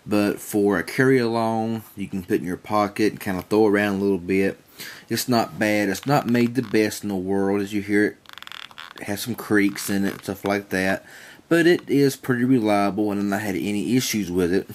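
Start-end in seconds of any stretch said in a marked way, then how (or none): none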